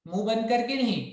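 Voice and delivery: ordinary talk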